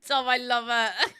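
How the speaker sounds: slow, laughing through the words; can't stop laughing at the joke; giggling delight at a viewer. Real, not acted. Laughing at chat message.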